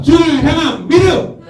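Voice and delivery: high, ringing final cue; high call and response lead; rallying call. Real, not acted. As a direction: rouse the crowd for one last answer. Last cue for shouted response.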